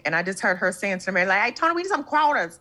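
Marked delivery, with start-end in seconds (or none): 1.4-2.6 mock Southern accent from Atlanta, pitched up; thick Atlanta accent. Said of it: "her accent was so thick".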